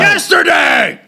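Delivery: high, slow mock scream; drawn-out comic yell; Adam Sandler. Comic echoing a Sandler movie line, high yell. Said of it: "that was Adam Sandler".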